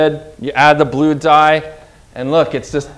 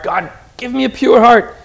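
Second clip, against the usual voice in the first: crying out to God; 8 semitones higher than usual. Retold or imitated. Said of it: "Do you cry out like that for God?".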